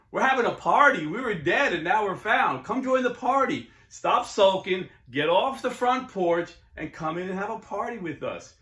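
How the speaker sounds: booming, excited welcome to the sulking brother; joyful invitation. Pretend. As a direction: call him into the celebration. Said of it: "reaching out to him and saying".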